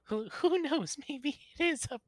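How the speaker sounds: high, loud flamboyant lady voice; camp high voice. Acted. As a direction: swoop up high and theatrical.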